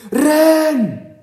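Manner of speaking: long, high-pitched full yell; screamed. A narrator's reading. Art warning his friend of a shadow in the woods, screamed. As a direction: scream it out loud as an urgent warning. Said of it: "schrie Art".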